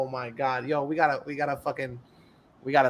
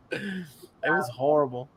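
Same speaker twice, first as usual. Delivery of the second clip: ordinary delivery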